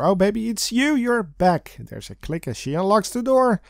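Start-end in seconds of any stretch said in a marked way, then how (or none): 0.0-1.8 lightly voiced seductive woman; sexy voice. A narrator's reading. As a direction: purr it a bit higher. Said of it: "Fawn's sexy voice oozes".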